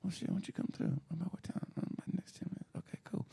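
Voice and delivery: very soft, hushed mock flirting; talking in her ear. Man imitating a secret hookup during a hug, hushed. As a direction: imitate both sides in a near-whisper. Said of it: "you talk in her ear".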